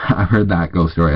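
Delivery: ordinary delivery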